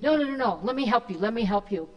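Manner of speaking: imitating an insistent, panicked helper; loud, urgent run of repeated protests; frantic. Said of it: "she's just frantic".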